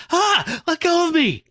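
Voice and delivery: high-pitched acted shriek of alarm; panicked outcry. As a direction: cry out as if fighting off a grip. Jess screams at her hallucination.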